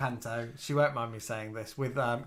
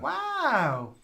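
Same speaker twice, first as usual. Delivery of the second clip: high, drawn-out wow; amazed; 11 semitones higher than usual. Real, not acted. Reacting to news of a famous ancestor, amazed. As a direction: let the word soar in astonishment.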